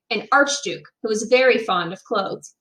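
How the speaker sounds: plain reading voice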